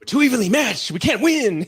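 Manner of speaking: loud, high, laughing declamation; mock battle cry. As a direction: shout it high like a hammed-up fighter. Acts out two evenly matched fighters.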